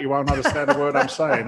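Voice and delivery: ordinary talk, nothing marked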